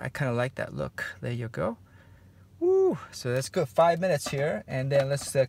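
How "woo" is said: sudden high woo of delight; high whoop. Real, not acted. Painter whoops at his canvas, delighted. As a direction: let out a happy high whoop.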